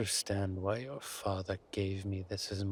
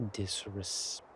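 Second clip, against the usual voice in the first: normal talking voice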